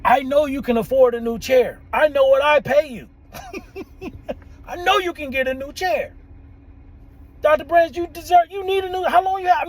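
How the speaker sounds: a playful scolding from a client; calling him out. Retold or imitated. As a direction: call him out hard on the cheap chair. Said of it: "she called me out".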